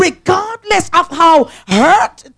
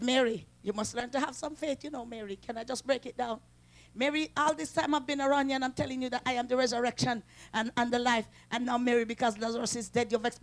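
gentle Jesus; 4 semitones lower, 17 dB quieter than usual. Retold or imitated. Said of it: "He said".

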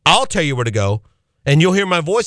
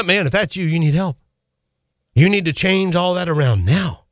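normal talking voice

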